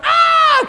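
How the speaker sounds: high, loud mock baby wail. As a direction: wail like a toddler fishing for attention. One-year-old falls down.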